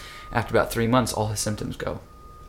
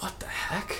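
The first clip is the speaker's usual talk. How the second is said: stunned aside; 9 semitones higher, 10 dB quieter than usual. Retold or imitated. Dad stunned in cellar.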